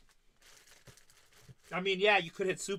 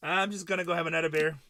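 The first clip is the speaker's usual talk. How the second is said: louder, punched out as a proud exit; mock loud declaration; 2 semitones higher, 8 dB louder, faster than usual. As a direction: announce it to the room. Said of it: "She's like".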